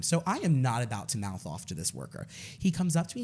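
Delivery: ordinary talk, nothing marked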